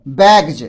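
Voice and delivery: बैग्स ending in a voiced "j"; final j sound. Shown as a demonstration. Teacher shows how to read बैग्स, final s turned to j. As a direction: say बैग्स as "baig-j", not "baiks". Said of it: "एस को हम लोग ज पढ़ते हैं".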